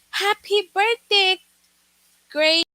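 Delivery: high, loud, cheerful birthday wish; excited exclamation. Shown as a demonstration. Teacher demonstrates an exclamation to the class, excited and loud. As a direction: sing out a happy birthday like you mean it. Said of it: "For example".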